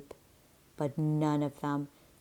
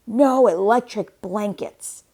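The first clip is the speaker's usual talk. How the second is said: loud, higher flat refusal from the farmer; firm refusal; 6 semitones higher, 9 dB louder than usual. A narrator's reading. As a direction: slam the door on the idea, loudly. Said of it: "said Farmer Brown".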